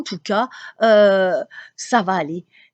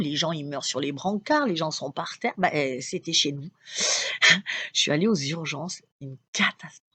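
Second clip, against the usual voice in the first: ordinary delivery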